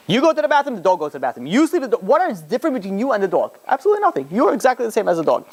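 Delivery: high, emphatic retold argument; retold heated argument. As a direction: argue it point by point, pitch jumping high. Rabbi arguing dog and man alike.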